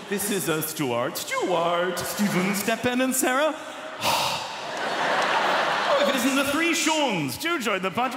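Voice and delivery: high, loud, drawn-out introductions; gushing party host. Pretend. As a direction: gush over every guest like an over-excited hostess. Acted party of same names.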